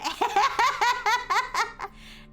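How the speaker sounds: high burst of laughter; laughing. Real, not acted. Amused by a chat message, bursts out laughing. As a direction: laugh out loud.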